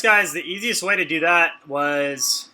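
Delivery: ordinary talk, nothing marked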